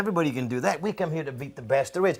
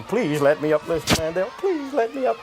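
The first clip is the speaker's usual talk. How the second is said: imitated pleading, climbing much higher by the end; mock screaming plea; 5 semitones higher than usual. Wrestler mimicking his trapped opponent begging for release, mock screaming. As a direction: beg like a beaten opponent, hammed-up. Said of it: "and this boy screaming".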